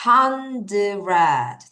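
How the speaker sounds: hundred drawn out in slow syllables; slow teaching model of hundred. Shown as a demonstration. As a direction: stretch hundred into hun-d-red. Teacher rebuilds word slowly.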